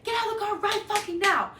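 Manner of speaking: screaming at her friend. Retold or imitated. Yelling at her friend to flee the fake Uber, frantic scream. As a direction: scream at Juju to get out now. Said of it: "I start cussing this man".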